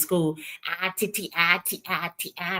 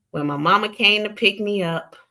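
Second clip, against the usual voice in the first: ordinary talk, nothing marked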